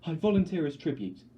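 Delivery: high, loud and urgent; desperate declaration. Acted. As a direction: throw yourself forward with the words. Volunteering for her sister.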